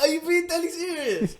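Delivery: high, loud laughing disbelief. Real, not acted.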